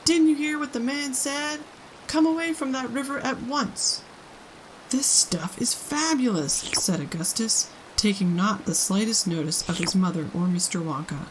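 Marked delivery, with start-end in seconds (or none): 0.0-4.0 high, stern mother; scolding mother. A narrator's reading. 4.9-6.7 higher, slow, savoring; greedy boy. A narrator's reading.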